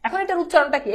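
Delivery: usual speaking voice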